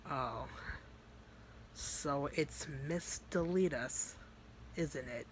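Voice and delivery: slow, soft recognition with menace; threatening. Retold or imitated. Vice recognising Monica, softly threatening. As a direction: draw out the name, quietly taunting. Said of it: "they just literally were just threatening each other".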